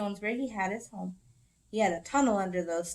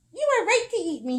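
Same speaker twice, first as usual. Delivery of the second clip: higher small squirrel plea; high pleading voice; 10 semitones higher, 6 dB louder, faster than usual. Acted. Squirrel pinned under Bear, high pleading.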